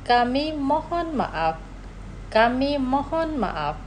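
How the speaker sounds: "Kami mohon maaf" said slowly twice, each syllable clear; slow model phrase. Shown as a demonstration. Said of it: "Los pronunciaré despacio". ("Kami mohon maaf" despacio para repetir)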